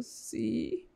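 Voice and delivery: breathless whispered reply; whispered yes. Retold or imitated. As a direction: barely voice the word, tearful. Mother answering while crying. (sí susurrado entre lágrimas)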